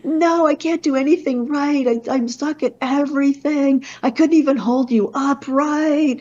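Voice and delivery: high, loud mock-sob of a crying teen; a boy sobbing out his misery in the car; imitating sobbing. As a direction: voice him wailing that he fails at everything. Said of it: "And he's sobbing".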